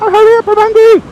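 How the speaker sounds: shrieky high mock complaint; loud, squealing hammed-up impatience; impatient hunger. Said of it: "they take ages to dock".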